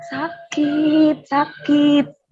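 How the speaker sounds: drawn-out, mildly whimpered moan; imitated crying. Herself crying in pain at night, imitated moaning. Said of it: "dia denger kita nangis".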